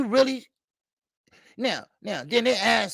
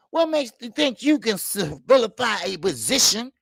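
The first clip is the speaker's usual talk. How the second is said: usual speaking voice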